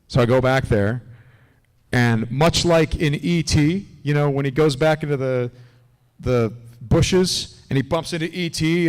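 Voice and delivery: ordinary delivery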